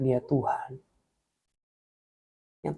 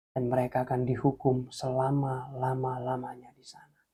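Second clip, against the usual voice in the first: grave whispered warning; 3 semitones lower, 8 dB quieter than usual. Retold or imitated. God tells Jim of eternal punishment, whispered. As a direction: whisper it like a dreadful secret.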